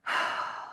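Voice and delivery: big audible exhale of release; big sigh. Shown as a demonstration. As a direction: demonstrate a long, soft, voiceless sigh. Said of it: "as simple as a big".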